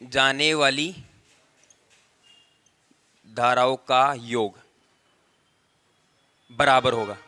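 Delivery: low, halting phrases with long pauses; slow dictation. Real, not acted. Teacher writing out the current rule, low slow dictation.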